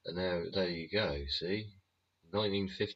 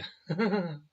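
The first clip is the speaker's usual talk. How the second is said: a sudden squeal of laughter, high and loud; high-pitched cackle at own joke; 12 semitones higher, 5 dB louder than usual. Real, not acted.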